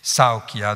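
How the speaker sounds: « Saokia » en dactyle: SAO tenu, ki-a brefs; une longue, deux brèves. Shown as a demonstration. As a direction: appuyer le début de « Saokia », abréger la fin. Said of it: "donc deux brèves". (« Saokia » scandé, longue puis deux brèves)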